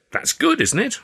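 bright rising question; hopeful surprise. A narrator's reading. Narrator voices himself hopeful.

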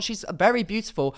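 normal talking voice

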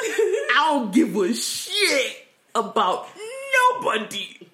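slow, loud, high, laughing through it; laughing outburst. Real, not acted. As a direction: blurt it out while cracking up. Laughing outburst about unrequited love.

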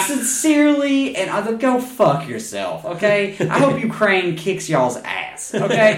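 high, slow, pointed sign-off; mock sincere. Real, not acted. Telling off the Russian woman, slow mock-sincere. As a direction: lay it on with fake politeness. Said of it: "fuck you, okay?".

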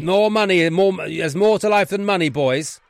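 loud, high rebuke; exasperated headmaster. Acted. Headmaster to boys who took money, exasperated. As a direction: pounce on their excuse and moralise. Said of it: "One is the Wrexham Headmaster's Office".

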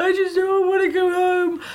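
high-pitched retold wail; tearful wail. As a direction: whine it out, acting out your own meltdown. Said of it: "I just lost it".

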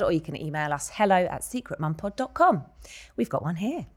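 normal talking voice

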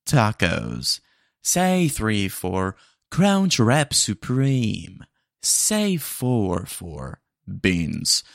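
very slow, loud robotic listing; drawn-out drive-thru bot voice; automated menu voice. Acted. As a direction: read options like a phone menu. AI bot lists menu options.

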